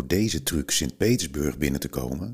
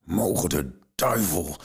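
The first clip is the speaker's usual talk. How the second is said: bellow; 3 semitones higher than usual. A narrator's reading. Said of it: "brulde een fourageur".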